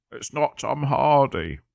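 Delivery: lightly voiced gruff film-star mumble, slow; a hint of a brooding movie tough guy, drawn out; mild imitation of a brooding actor, slowed down; Tom Hardy impression. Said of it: "That's my impression of whoever does Tom Hardy".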